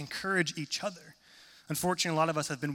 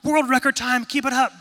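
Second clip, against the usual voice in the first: much higher and louder cheering; cheering crowd; 9 semitones higher, 11 dB louder than usual. Retold or imitated. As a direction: yell encouragement from the sidelines.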